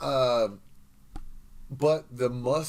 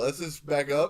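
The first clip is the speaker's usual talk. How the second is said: normal talking voice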